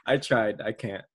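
ordinary talk

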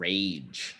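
"rage" drawn out slowly with its final soft g; slow, deliberate "rage"; emphatic RAGE. Shown as a demonstration. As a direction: stretch "rage" to correct the mishearing. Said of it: "I'm saying rage".

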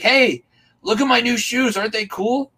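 put-on high, loud excited little boy; little kid. Little boy showing a stranger his shoes, high and loud. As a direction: show off new shoes like a proud kid. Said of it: "The little dude's like".

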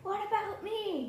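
high-pitched little question; high, innocent character voice; naive interruption. Acted. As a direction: pipe up in a high voice. Player interrupts speech in character.

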